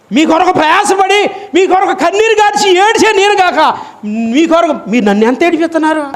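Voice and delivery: high, impassioned pulpit cry; preaching at full force; fiery preaching. Acted. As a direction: plead with the congregation from the pulpit.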